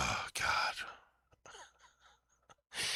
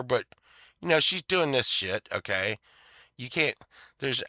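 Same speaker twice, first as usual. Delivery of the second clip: ordinary talk, nothing marked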